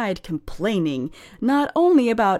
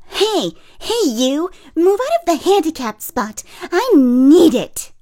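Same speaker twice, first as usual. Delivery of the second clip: loud, higher mock yell at a stranger; retold yell; 8 semitones higher, 8 dB louder than usual. A stranger demanding the parking spot, yelling. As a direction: bark it like an entitled stranger. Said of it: "I hear someone yelling".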